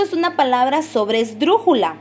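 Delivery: ordinary delivery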